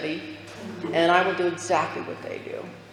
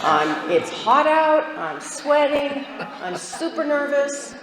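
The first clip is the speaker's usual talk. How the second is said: slow pleading excuses; 9 semitones higher, slower than usual. Retold or imitated.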